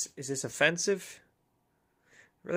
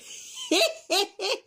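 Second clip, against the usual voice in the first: high haughty laugh. Acted. Laughing in character.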